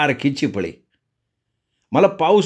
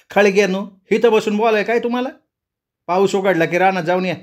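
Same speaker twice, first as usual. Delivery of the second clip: lightly voiced cross mother, higher pitch; scolding mother; 7 semitones higher than usual. A narrator's reading. Mother chiding children sitting around talking, scolding.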